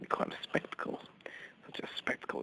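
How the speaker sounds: soft, unvoiced hushed brag; whisper. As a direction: whisper it like a dirty secret. Hushed boast about himself.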